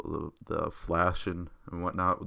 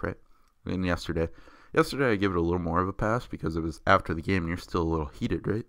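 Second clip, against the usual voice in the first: ordinary delivery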